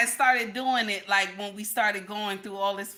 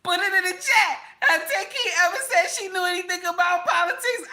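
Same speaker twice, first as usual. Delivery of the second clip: calling out; 7 semitones higher, 3 dB louder than usual. Real, not acted. Calling out to chat.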